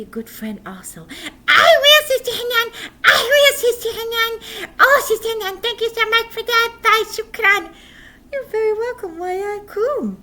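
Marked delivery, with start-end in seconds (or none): 1.5-10.2 put-on high, loud puppet boy; bright thankful little voice; grateful puppet. Puppet boy thanks his teacher, high and loud.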